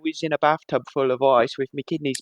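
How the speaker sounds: put-on chirpy London paper boy accent; British paper boy voice. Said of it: "[name] is doing an impression of a British paper boy".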